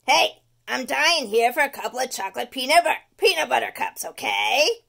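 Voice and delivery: loud, pushy candy craver; demanding tooth. Acted. The tooth demanding peanut butter cups, loud. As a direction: bark for candy like a greedy monster.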